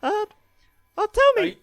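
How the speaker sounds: squeaky helium-high character voice. Acted. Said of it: "we turned on the helium".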